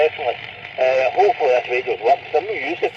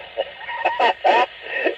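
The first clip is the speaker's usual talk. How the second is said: amused laugh; 4 dB quieter than usual. Real, not acted. Laughing at signal report.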